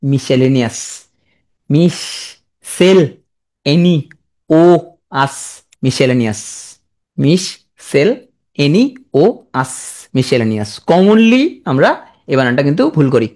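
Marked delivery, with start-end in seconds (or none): 1.7-5.6 "মিস, সেল, এনি, ও, আস" with long gaps; broken into syllables. Shown as a demonstration. Teacher breaking down a hard spelling, slow isolated chunks মিস সেল এনি ও আস. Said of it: "উচ্চারণ করবা কি বে?". 7.2-9.8 "মিস, সেল, এনি, ও, আস" with long gaps; broken into syllables. Shown as a demonstration. Teacher breaking down a hard spelling, slow isolated chunks মিস সেল এনি ও আস. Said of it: "উচ্চারণ করবা কি বে?".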